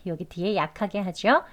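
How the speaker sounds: usual speaking voice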